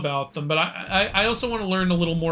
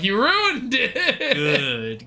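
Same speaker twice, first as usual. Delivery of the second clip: mock complaint; 4 semitones higher, much slower than usual. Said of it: "She'll be like".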